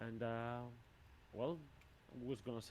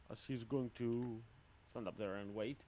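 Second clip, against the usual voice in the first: ordinary talk, nothing marked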